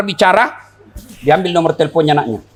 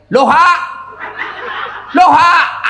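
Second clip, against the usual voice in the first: shouting backwards hello; 11 semitones higher, slower than usual. Retold or imitated. Villager yelling the reversed hello into the phone. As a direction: yell the flipped hello. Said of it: "bila lagi".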